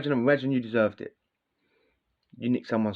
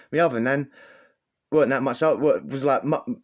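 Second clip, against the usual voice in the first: ordinary talk, nothing marked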